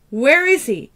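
high, loud shouted demand. Acted. Daughter punching the man for answers, loud shout. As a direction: yell it as you hit him.

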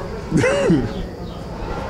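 breathy bashful giggle; a quick embarrassed laugh. Real, not acted. Shy laugh about falling in love. (petit rire gêné)

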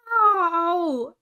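high, loud yelp of pain; scream. Acted. Roo crying out as the branch hits his tail, loud scream. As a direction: yell out as the branch pins you. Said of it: "screamed Roo".

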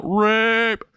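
very high, held cry of alarm; hammed-up shriek for help; mock scream. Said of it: "Izuku just screams out".

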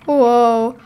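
ordinary delivery

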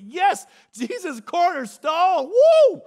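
rising to a joyful high shout; mock worship excitement. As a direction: hype it up like Sunday praise.

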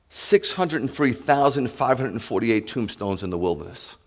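usual speaking voice

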